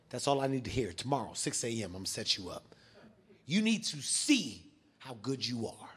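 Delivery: low and soft, slowing on the last line; trainer recruiting. Retold or imitated. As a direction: imitate a low-voiced trainer laying down a plan. Trainer recruiting him, acted.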